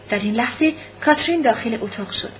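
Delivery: ordinary delivery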